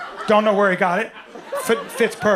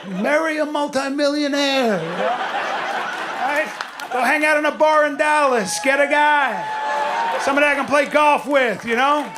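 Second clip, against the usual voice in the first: higher, louder, half-shouted advice to a daughter; mock dad pep talk; 4 semitones higher, 3 dB louder than usual. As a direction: boom it out like a pushy dad barking orders. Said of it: "there's a part of me that's like".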